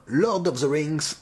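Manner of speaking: slow English with a French accent; bad English accent. Real, not acted. French host reading the figurine's stand, bad English accent. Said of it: "mon accent anglais est toujours extraordinairement mauvais".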